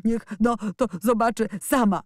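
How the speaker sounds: a mild stammer between sobs; boy stammering between sobs for the nurse to show her; stammered through sobs. A narrator's reading. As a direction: get it out haltingly. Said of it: "wyjąkał między dwoma szlochami".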